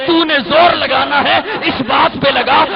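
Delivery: shouted refrain. Acted. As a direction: hurl the line at the crowd.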